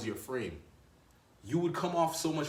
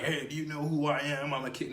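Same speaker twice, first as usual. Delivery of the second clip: insecure king; 2 semitones higher than usual. Retold or imitated. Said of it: "a insecure king".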